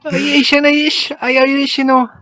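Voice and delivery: badly faked Irish accent; high, hammed-up Irish; terrible Irish accent. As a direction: put on a comically bad Irish brogue. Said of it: "That's terrible".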